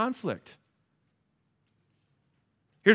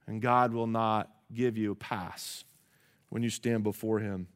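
ordinary talk, nothing marked

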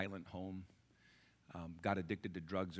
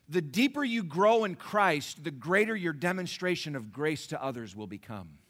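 strong preaching; 8 semitones higher, 11 dB louder than usual. Shown as a demonstration. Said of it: "repeated louder".

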